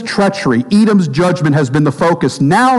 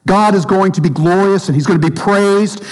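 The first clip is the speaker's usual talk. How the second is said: ordinary talk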